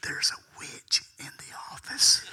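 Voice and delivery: loud, forceful whisper; stage whisper. Retold or imitated. Caller warning of a witch, loud whisper. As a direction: whisper an alarming secret hard. Whispered reply in retold call.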